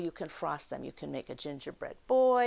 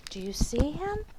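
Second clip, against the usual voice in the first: usual speaking voice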